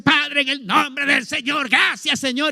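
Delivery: high, slow, lightly hammed-up pleading prayer; mock fervent prayer. Believer praying alone over Zoom, mock earnest high prayer. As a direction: imitate someone praying earnestly at home. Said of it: "y ponerse".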